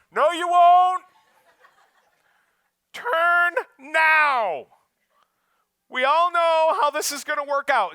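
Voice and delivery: loud, high scolding inner voice; nagging inner voice. Acted. Inner voice scolding a driver to get gas, loud and high. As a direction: bark at yourself to turn now.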